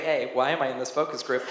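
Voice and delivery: ordinary talk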